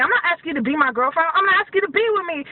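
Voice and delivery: ordinary delivery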